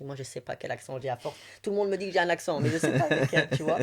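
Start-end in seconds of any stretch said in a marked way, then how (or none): 1.6-3.8 fast, louder, laughing through it. Real, not acted. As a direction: let the giggle break through the words. Laughing about her unknown accent.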